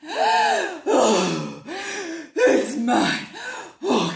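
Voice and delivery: loud breathless relief; gasping. Acted. Mister Brown gasping as he finds his case. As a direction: gasp it out. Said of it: "gasped Mister Brown".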